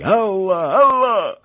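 high, loud yelling; war cries. A narrator's reading. Tatar horsemen chasing the fugitives, shrieked war cry. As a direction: scream it like a charging horde. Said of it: "wyraźne wrzaski".

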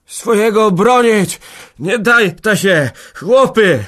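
loud, high, slow rallying cry; delirious full-force yell; broken shouting. A narrator's reading. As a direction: shout in torn bursts, rallying the men. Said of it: "krzyczał, ale słowa mu się rwały".

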